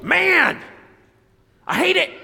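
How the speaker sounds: high-pitched exasperated outburst; angry Jonah. Acted. Preacher voices Jonah hating that the city repents, angry outburst. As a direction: burst out high and loud, fed up. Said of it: "He's angry".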